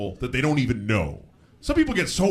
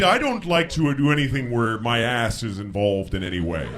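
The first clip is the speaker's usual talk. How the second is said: ordinary talk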